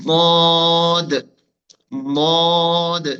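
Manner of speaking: দদ loud and heavy, tongue side pressed to upper molars; demonstrating Dad. Shown as a demonstration. Teacher models the difficult letter, loud drawn-out দদ. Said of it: "সেক্ষেত্রে আপনি উচ্চারণ করবেন".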